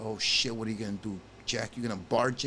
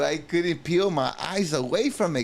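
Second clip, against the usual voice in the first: emphatic repeat; 5 semitones higher, 4 dB louder than usual. Shown as a demonstration. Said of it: "laughing repeat".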